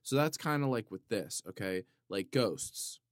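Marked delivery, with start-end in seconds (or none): none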